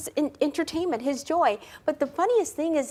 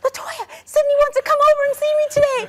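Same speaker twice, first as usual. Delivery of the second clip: high, loud, rushed squeal of news; high-pitched mock-excited shout; imitated excitement; 13 semitones higher, 7 dB louder, much faster than usual. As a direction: burst out breathlessly fast, imitating an overjoyed brother. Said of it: "he was just so excited".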